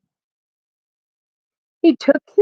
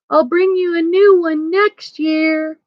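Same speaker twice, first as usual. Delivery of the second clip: high, sing-out farewell call; 8 semitones higher than usual. A narrator's reading. Santa promises the bears a chair as he leaves, calling out. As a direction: call it back as if from a distance. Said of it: "Santa called".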